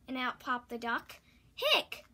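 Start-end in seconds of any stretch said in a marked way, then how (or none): 1.6-2.1 loud high hiccup sound. Acted. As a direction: hiccup it out sharply. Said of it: "went the cat".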